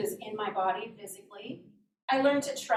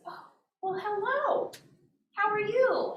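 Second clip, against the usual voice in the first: high, surprised grown-up hello; friendly principal greeting; 10 semitones higher than usual. Retold or imitated. Principal stops to greet a child, surprised and high-pitched. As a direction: play a pleasantly surprised principal.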